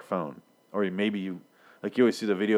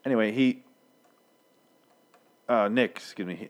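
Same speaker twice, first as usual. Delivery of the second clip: ordinary delivery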